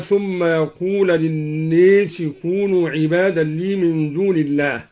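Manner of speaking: للناس recited with long e for a; imala on للناس. Shown as a demonstration. Teacher giving a further example, للناس with imala. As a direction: tilt the vowel of للناس towards e. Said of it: "وأمال".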